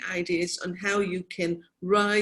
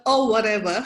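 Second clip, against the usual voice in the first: dismissive; 6 dB louder than usual. Retold or imitated. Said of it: "I love the".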